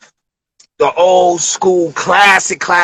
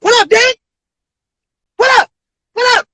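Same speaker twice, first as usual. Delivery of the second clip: much higher, louder call-outs; hyped greeting; 3 dB louder than usual. Retold or imitated. Whole room greets Dave Chappelle arriving, high and hyped. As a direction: hail him as he walks in.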